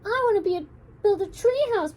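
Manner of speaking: high, insistent demand; arguing. A narrator's reading. Meg insists to Simone on her tree house, arguing. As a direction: push your own plan hard. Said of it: "They were arguing".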